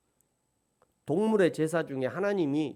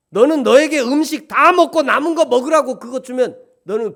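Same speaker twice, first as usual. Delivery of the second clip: loud, higher voice of God scolding; raised reproachful God; indignant rebuke; 8 semitones higher, 12 dB louder than usual. Acted. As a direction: scold them like an offended God.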